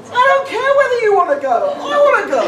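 high mock wife, laughing; high insistent wife, laughing.

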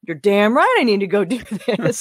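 punchy, laughing agreement; emphatic yes. Retold or imitated. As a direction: belt out the eager yes. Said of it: "he sent me a message going".

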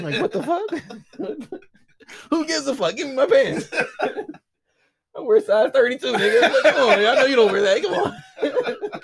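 high, loud laughing complaint; laughing rant. Real, not acted. Mocking the neighbor who took his pants, laughing.

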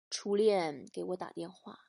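quiet, slow, glum confession; a husband faking sorrow to cover his smoking; gloomy husband. Acted. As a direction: sigh it out like a troubled man. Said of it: "一脸忧愁地说".

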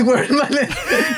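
amused at being caught unprepared; laughing. Real, not acted. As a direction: laugh while hunting for something missing. Can't find the list, laughing.

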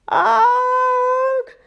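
high, loud scream of pain; robber's scream. Acted. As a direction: yelp as you bolt. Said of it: "screamed the robber".

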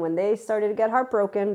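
usual speaking voice

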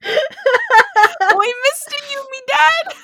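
very high-pitched, overdone British squawk; bad British accent. Host repeating the joke through giggles, bad British accent. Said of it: "do a bad British accent".

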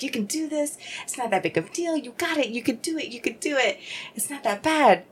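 high-pitched mock pep talk to herself; self pep talk. Hyping herself up in her head, high coaching voice. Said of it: "I really hyped myself up".

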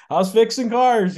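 high, loud teasing question; chuckling dad. Retold or imitated. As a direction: imitate a dad amused at his kid's job. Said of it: "dad would just kinda laugh and chuckle and say".

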